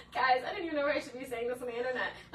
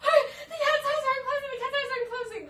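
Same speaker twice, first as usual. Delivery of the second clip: panicked mom; 12 semitones higher, 6 dB louder, faster than usual. Retold or imitated. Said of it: "she's like".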